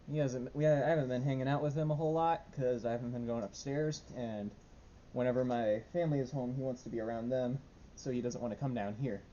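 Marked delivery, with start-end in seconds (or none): none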